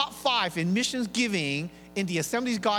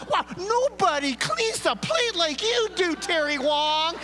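high, slow and louder, sweetly gushing; gushing wife praise; 10 semitones higher, 4 dB louder, slower than usual. Retold or imitated. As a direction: pour on the praise like a delighted wife. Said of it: "she says".

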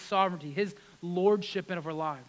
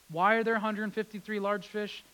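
normal talking voice

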